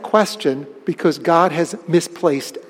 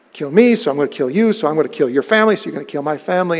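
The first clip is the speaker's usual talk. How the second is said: rapid chain; 3 semitones lower, much faster than usual. Retold or imitated. Retelling escalating blood feud.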